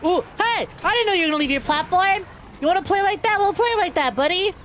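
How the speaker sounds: excited taunt. Real, not acted. Enemy leaves platform, streamer taunts.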